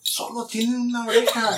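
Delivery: high-pitched quoted reproach; high imitation of a scolding mom; imitated mom. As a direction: voice a mother's reply, pitched well up. Quotes mother's reply in Spanish.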